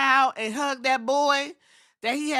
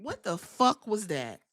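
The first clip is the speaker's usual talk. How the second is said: normal talking voice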